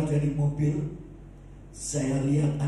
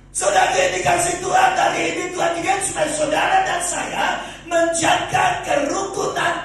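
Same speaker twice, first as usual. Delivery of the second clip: high, loud exhortation dropping in pitch; full-force preaching; 7 semitones higher, 6 dB louder than usual. Real, not acted. Preacher urging harmony in the household, full-force preaching. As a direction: proclaim it to the whole congregation.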